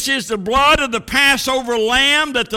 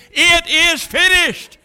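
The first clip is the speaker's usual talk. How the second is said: high, triumphant proclamation; heralding; 8 semitones higher, 4 dB louder than usual. Acted. Angels on streets of gold, heralding the message. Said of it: "heralding that message".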